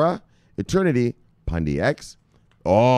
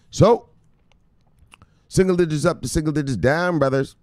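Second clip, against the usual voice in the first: hyped call; 6 semitones higher than usual. Acted.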